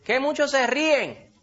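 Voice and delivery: high, slow indignant whine; mock outrage. Preacher mocking bitter people appalled at laughter. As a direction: act scandalized that people laugh. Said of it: "Unos amargados dicen".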